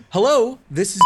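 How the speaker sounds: high, bright sing-song hello; chipper phone greeting. Shown as a demonstration. Coworker at work, answering the phone, overly cheerful high greeting. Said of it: "answering the phone at work like that".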